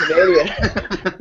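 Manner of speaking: slow, laughing Canadian send-up; mock Canadian accent. Friends sending up Canadian speech, mock Canadian accent.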